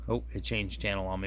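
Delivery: usual speaking voice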